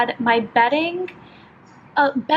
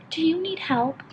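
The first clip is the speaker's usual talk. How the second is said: soft, higher-pitched offer of help; sweet helpful stranger; 4 semitones higher, 5 dB quieter than usual. Retold or imitated. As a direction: imitate a gentle, kindly stranger. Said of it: "the sweetest looking thing".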